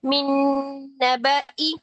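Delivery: मिन नबई with short i, no long ya; नबई said short, not नबई-ई; Qur'anic recitation. Shown as a demonstration. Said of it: "इस condition में पढ़ना है".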